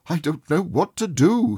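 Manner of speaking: high, flustered cry of joy; giddy outburst, not knowing what to do; cried out. A narrator's reading. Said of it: "he cried".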